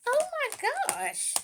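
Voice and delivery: high, slow drawn-out oh my gosh; surprised gasp. Real, not acted. Seeing the swatch come out, high amazed gasp. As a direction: let it burst out in surprise.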